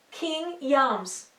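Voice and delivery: James said as Yahms, with a Y; "King Yams" with Y for J; old spelling pronunciation. Shown as a demonstration. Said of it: "the J wasn't being really".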